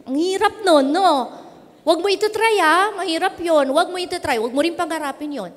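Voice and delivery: ordinary talk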